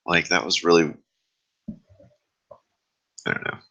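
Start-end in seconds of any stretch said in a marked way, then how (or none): 1.7-3.6 muttered aside. Real, not acted. Trailing aside while searching for words.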